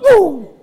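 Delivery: high "woo!" sliding down; a quick high mock whoop; retold whoop. Preacher acting out flooring it in traffic, high whoop. Acts out praying while driving.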